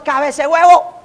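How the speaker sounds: burla infantil. Retold or imitated. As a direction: taunt it like a cheeky pupil. Muchachos burlándose del profesor calvo. (burla de muchacho, algo más aguda)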